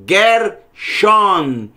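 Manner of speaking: "Gershon" split in two, hard g, open n ending; open final N. Shown as a demonstration. Teacher restoring the correct Hebrew name, open final n. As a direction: say "Gershon" slowly, lips apart at the end.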